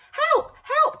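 high, loud panicked shout; frantic cry for help. A narrator's reading. Carrot stuck on the belt, screaming for help. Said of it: "cried Carrot".

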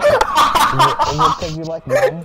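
high, loud teasing through laughter; mock him while laughing; laughing tease. Real, not acted.

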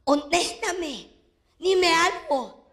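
angry mother demanding. Retold or imitated. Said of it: "mi mamá me decía".

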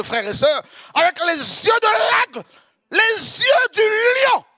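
high, loud, slowing shout; fervent shout. Real, not acted. Preacher describing the Lord's eyes to the church, fervent shout. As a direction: roar out the eyes of the lion.

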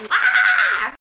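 loud high yelp of shock; startled scream; sudden shriek. Real, not acted. Vlogger yelps at an unexpected sound.

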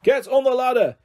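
high-pitched urgent shout; shouted order. A narrator's reading. Officer leaning out of the helicopter, shouted order. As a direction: call the command down from above.

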